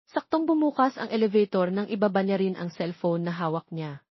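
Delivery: usual speaking voice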